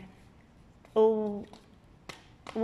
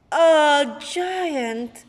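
shocked; 4 semitones higher, 7 dB louder than usual. Acted. Seed sees a giant.

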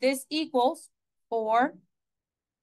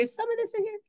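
mock wondering; 9 semitones higher, 5 dB quieter, faster than usual. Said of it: "I was like".

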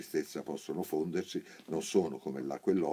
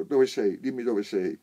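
domanda gridata, acuta e forte; grido; 6 semitones higher, 7 dB louder than usual. A narrator's reading. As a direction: chiamala gridando, con urgenza. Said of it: "gridò il primo cieco".

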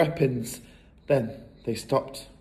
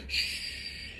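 soft voiceless shush; hushing; 14 dB quieter than usual. A narrator's reading. Matilda hushes the family to listen, shushing.

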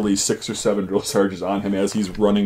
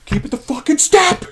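much higher furious screamed order; screaming drill sergeant; 3 dB louder than usual. Retold or imitated. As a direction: scream at the recruits to keep step. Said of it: "screaming really loud".

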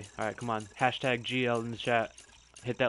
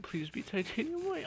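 high pleading; 5 dB quieter than usual. Real, not acted. Begging for rare color.

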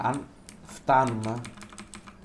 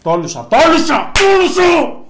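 high, loud repeated triumphant shout; excited yelling; 13 semitones higher, 15 dB louder, much slower than usual. Real, not acted. Gamer shouting in triumph at his big hit. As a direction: shout it out in victory.

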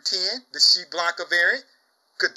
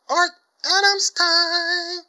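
much higher, louder, slow drawn-out call; hyped catchphrase; 13 semitones higher, 5 dB louder, much slower than usual. Real, not acted. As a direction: stretch Adams time like an announcer.

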